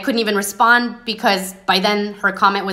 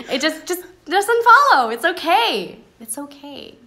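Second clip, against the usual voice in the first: high, bright and breezy; high sing-song brush-off, cheerily saying it's fine; cheery; 8 semitones higher than usual. Pretend. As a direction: wave them off cheerfully. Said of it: "cheery way?".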